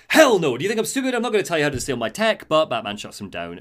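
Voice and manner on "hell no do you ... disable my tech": angry refusal. Retold or imitated. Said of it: "Freeze is like".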